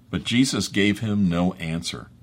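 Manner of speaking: ordinary delivery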